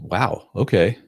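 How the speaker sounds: ordinary talk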